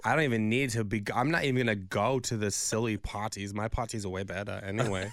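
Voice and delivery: a light, haughty put-on Anna Delvey accent; lightly voiced Anna Delvey accent, sniffing at rivals. Host mocks the nominees in a light Anna Delvey accent. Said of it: "Such a good impression of Julia Garner".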